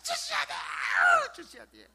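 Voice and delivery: very high, tearful shouted begging; hammed-up wail, insisting until it trails off; mock screaming demand. Parodying how people badger God in prayer, screeching demands. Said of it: "악다구리를 부린다고".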